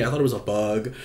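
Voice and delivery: ordinary talk, nothing marked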